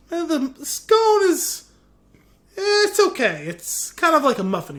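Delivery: high, noncommittal "eh", hedging the complaint; hesitant verdict. Retold or imitated. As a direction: act out a reluctant, picky customer. Acting out customer's hesitant verdict on scone.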